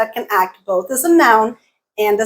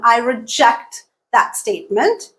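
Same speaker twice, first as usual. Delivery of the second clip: "reject" as re-JECT, second syllable stressed; verb stress. Shown as a demonstration. Teacher giving a verb example, second-syllable stress. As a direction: stress the end of "reject" within the sentence. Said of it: "you change the stress pattern and the vowel".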